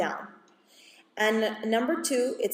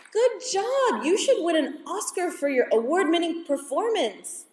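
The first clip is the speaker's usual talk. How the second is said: higher, mock-admiring congratulations; sarcastic praise; 6 semitones higher than usual. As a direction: tease the drama queen with fake applause. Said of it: "a funny way to do it".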